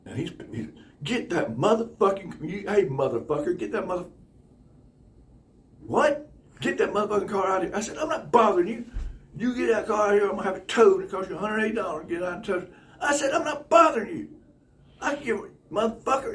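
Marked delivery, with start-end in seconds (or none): 1.0-7.8 acted cursing rant, pitched up; angry ordering. Acted quarrel with angry man. 8.0-16.3 high, loud back-and-forth squabble; acted indignant protest, pitched up; heated arguing. Acted quarrel over parked car.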